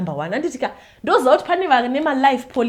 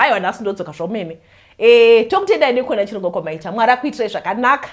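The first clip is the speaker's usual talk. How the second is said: ordinary talk, nothing marked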